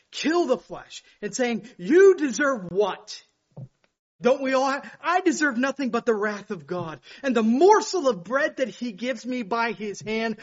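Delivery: full-force pulpit preaching; fiery preaching. Acted. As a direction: thunder it at the congregation.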